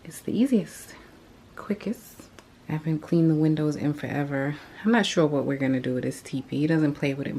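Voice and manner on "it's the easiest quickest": hushed whispered aside; whispering. Real, not acted. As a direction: whisper it like a secret. Whispered aside while cleaning.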